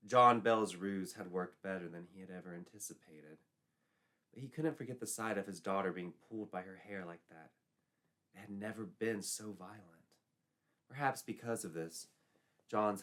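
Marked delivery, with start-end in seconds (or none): none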